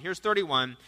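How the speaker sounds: ordinary delivery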